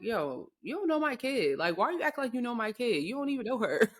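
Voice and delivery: high-pitched, quick mock indignation; indignant protest. Acted inner protest of a parent.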